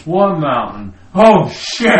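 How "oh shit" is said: high, loud, laughing shriek of doom; laughing mock panic. Pilot spotting the mountain too late, laughing and loud. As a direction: crack up while screaming the crash. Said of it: "pilot sees the mountain".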